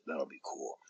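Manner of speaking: breathy whispered aside, almost no voice. Real, not acted. Wrestler tells the podcast hosts, in a whisper. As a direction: drop to a whisper for the punchline.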